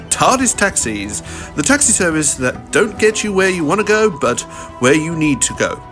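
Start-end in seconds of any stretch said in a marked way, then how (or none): none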